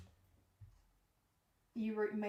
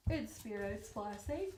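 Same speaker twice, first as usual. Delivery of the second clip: talking through a yawn; words stretched by a yawn; 3 dB quieter than usual. Real, not acted. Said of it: "I'm sorry, I keep yawning".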